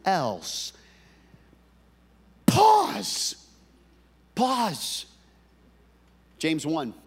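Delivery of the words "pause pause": high ringing Pause, stretched and falling; a high sung-out Pause to make the room stop; drawn-out pause. Shown as a demonstration. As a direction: call the word out high and long like a bell. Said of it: "Everybody say pause".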